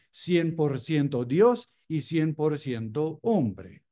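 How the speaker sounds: usual speaking voice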